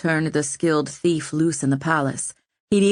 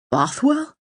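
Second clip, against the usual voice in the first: higher, shocked question on "Bothwell"; aghast; 9 semitones higher than usual. A narrator's reading. Owen hearing his enemy is back, aghast. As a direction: gasp the name in disbelief.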